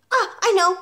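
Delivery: put-on high, eager little boy; schoolboy having a bright thought; sudden realisation. A narrator's reading. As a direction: burst out with the idea. Boy suddenly thinks of answer.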